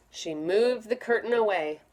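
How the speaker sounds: plain reading voice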